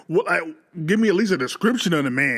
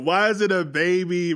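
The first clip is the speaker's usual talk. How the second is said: usual speaking voice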